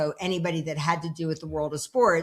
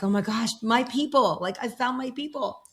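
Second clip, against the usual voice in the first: delighted gush; 6 semitones higher than usual. Retold or imitated. Retold joy at finding her people.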